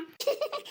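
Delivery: a short soft giggle, rising; soft teasing laugh. Acted. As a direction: giggle softly at his sulking. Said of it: "சிரிச்சு சிரிச்சு வயிறு வலிக்குது".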